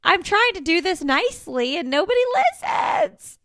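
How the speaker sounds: rising to a high strained cry on listens; frustrated. Real, not acted. Mom venting to a friend about kids ignoring her, rising exasperation. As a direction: vent it, building to a squeak of exasperation. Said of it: "it's frustrating".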